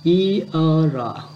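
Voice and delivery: "Y ơ rọ" drawn out piece by piece; slow sounding-out. Shown as a demonstration. Teacher breaks down a hard word, slow sounding-out. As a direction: stretch each sound of "Y ơ rọ" slowly. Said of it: "nhiều anh em không đọc được từ này".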